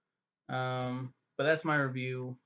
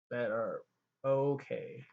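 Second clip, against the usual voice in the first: normal talking voice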